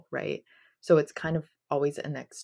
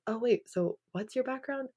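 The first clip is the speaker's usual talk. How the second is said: higher, softer questioning voice; imagined curious questioner; 8 semitones higher, 5 dB quieter than usual. Pretend. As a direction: imitate someone curiously asking, pitched up. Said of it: "if someone said".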